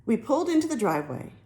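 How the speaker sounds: usual speaking voice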